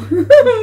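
sudden high-pitched laugh. Real, not acted. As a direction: burst out laughing. Burst of laughter.

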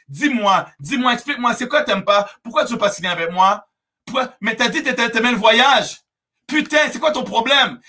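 hammed-up clingy pleading with insistent questions; acted desperation. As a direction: badger them like a desperate recruiter. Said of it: "vous êtes désespéré par texte".

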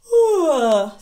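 loud exaggerated yawn; big yawn. Shown as a demonstration. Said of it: "you go like this".

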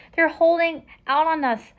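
a storybook captain roaring, held back; pirate's mild roar. A narrator's reading. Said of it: "roared the pirate captain".

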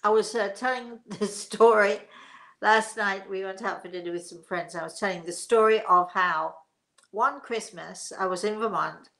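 ordinary talk, nothing marked

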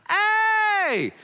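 sudden very high, loud shout; Peter shouting. Acted. Peter breaking into the talk on the mountain, a loud shout. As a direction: bellow it out to interrupt.

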